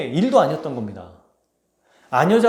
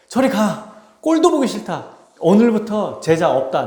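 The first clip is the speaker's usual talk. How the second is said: raised, high-pitched acted rebuke; hammed-up fed-up outburst; mock angry rejection; 4 semitones higher, 3 dB louder than usual. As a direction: play at disowning them in a huff. Imagined Jesus disowning his disciples.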